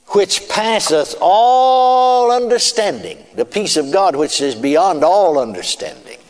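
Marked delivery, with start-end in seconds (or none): 0.1-3.2 high, slow preaching. Acted. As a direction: lift it up like a sermon. Raised solemn scripture line.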